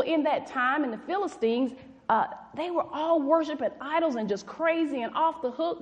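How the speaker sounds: usual speaking voice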